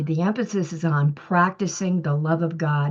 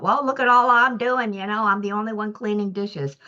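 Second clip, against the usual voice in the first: higher, whiny complaining rant; mock kitchen rant; 4 semitones higher than usual. To her husband in the kitchen, a raised whiny complaint. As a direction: replay her own grumble about doing all the work. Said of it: "I go off too much in the kitchen about".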